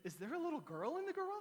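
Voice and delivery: put-on wife teasing husband about the little-girl scream. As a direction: tease him about the scream. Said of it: "and she goes".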